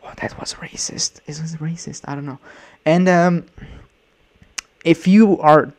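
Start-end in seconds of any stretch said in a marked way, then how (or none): 0.0-2.4 soft, half-whispered aside; hushed aside. Real, not acted. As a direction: drop to a near whisper, unsure. Self-conscious aside after mock accent.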